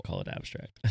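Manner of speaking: low whispered aside. Real, not acted. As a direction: slip it in under the breath. Sly aside about the shows.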